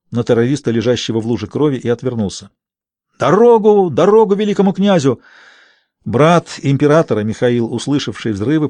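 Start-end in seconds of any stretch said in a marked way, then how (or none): 3.2-5.2 high, loud shout to clear the way; calling out. A narrator's reading. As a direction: call out over the crowd. (громкий крик, расчищающий дорогу)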